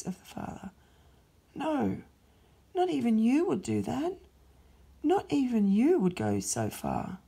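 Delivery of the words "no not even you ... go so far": higher, incredulous fallen angel; shocked Satan. Acted. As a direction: protest in disbelief, rising up.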